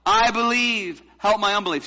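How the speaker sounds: loud, high-pitched recitation; crying out. Shown as a demonstration. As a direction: call it out for the congregation. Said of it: "Say it again".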